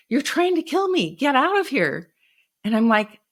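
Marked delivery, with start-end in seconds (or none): 0.1-2.1 high-pitched panicked cry, held back in volume; retold scream. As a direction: imitate a terrified father screaming, pitch way up. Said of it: "pretty soon he started screaming".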